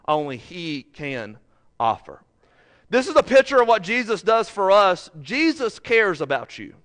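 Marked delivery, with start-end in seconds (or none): 2.9-6.7 higher and louder, full pulpit voice; rousing sermon delivery; fervent preaching. Real, not acted. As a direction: preach it straight to the crowd.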